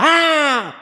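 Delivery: high loud scream of a grieving man; retold scream. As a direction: let out the cry he heard at night. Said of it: "sino que gritaba". (grito agudo y fuerte de dolor)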